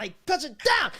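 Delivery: high, strained mock shout; acted straining effort. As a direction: act out forcing sickness back down. Acts out fighting back sickness.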